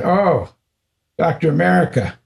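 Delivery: high, playful teasing call. Retold or imitated. Guru teasing his disciple, high and playful. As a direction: needle him like a fond guru. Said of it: "he started teasing me".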